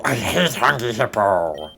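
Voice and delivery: slow, strained growl of hatred; drawn-out, harsh mock fury; mock rage. Real, not acted. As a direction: growl it out slowly, hammed-up.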